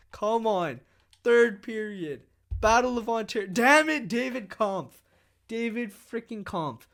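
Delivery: loud, high frustrated yells at the game; frustrated yelling. Real, not acted. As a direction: yell at the screen as they score. Reacting to goals against.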